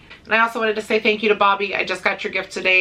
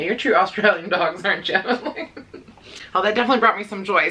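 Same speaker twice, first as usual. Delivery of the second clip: usual speaking voice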